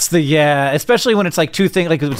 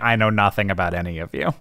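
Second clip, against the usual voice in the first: resigned future self; 8 semitones lower, 6 dB quieter than usual. Acted. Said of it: "I'll be like".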